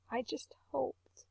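soft, breathless whispered admission; tearful whisper. A narrator's reading. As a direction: son confessing to his mother, barely voiced. Crying son confessing to mother.

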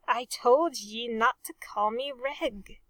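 ordinary delivery, nothing marked

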